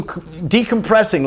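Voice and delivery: ordinary talk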